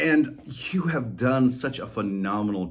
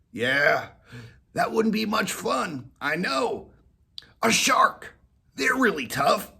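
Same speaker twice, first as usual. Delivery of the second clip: higher, eager collie voice; dog suggesting; 10 semitones higher than usual. Acted. Molly suggesting a shark, higher voice. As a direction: offer the shark.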